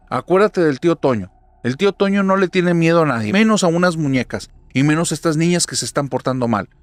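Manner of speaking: loud, high, pressing and insistent; rally your scared little sister; urgent encouragement. Acted. Sara urges her little sister to stand up, loud and insistent.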